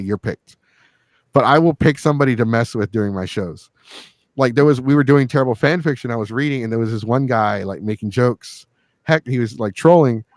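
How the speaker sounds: ordinary talk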